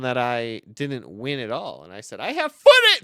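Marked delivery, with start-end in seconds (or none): 2.2-3.0 high, loud protest; yelled rebuttal, much higher; indignant shout. Retold or imitated. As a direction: shout it in high-pitched defiance. Retort to a rival's lies.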